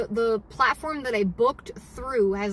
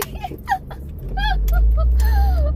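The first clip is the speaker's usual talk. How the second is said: squealing burst of laughter, much higher; high delighted laugh; 7 dB louder than usual. Real, not acted. Reading a viewer's supportive comment, delighted laugh.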